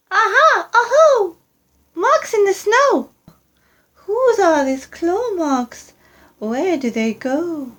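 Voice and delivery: put-on high eager child creature; Gruffalo's child. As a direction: squeak it out like a curious young monster. Child finds claw marks.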